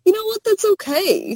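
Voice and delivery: high, gentle reassurance; mock Hal. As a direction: lift it up high and sweet. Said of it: "And he's like".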